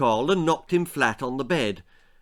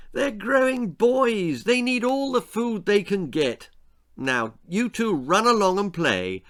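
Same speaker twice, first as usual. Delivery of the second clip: motherly aunt; 8 semitones higher than usual. Acted. Aunt Fanny defends the boys to Uncle Herbert, high motherly voice. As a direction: fuss over the growing boys.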